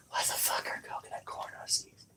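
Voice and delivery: hushed baffled whisper. Real, not acted. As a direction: whisper it to yourself in disbelief. Baffled aside to herself.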